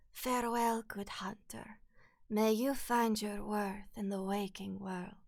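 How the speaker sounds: soft, slow whispered farewell; the Doll. Acted. Game doll bidding the hunter farewell, slow whispered blessing. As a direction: whisper it slowly, with a light Russian tinge. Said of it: "I can do the doll, too".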